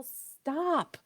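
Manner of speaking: put-on a kid's yell at ordinary volume; mock child's yell. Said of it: "maybe they just yell".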